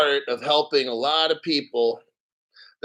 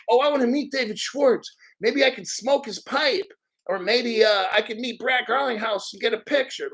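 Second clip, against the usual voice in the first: high, giddy mock fanboy voice; mocking starstruck fanboy; 7 semitones higher than usual. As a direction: lampoon a starstruck fan in a high voice.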